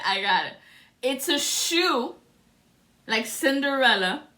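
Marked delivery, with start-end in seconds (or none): 1.0-4.2 delighted. Real, not acted. Delighted over the shoe.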